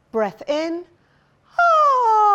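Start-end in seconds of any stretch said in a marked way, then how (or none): 1.6-2.4 sliding vocal siren. Shown as a demonstration. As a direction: send the voice away with a long sliding ah. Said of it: "I'll show you what I mean".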